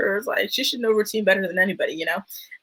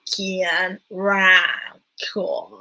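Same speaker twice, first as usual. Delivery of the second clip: drawn-out Southern drawl, word by word; Southern accent; much slower than usual. Retold or imitated. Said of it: "I love the way they say".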